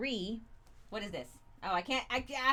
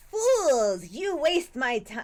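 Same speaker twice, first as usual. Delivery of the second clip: villain voice; 4 semitones higher, 7 dB louder, slower than usual. Acted. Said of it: "it's probably more evil".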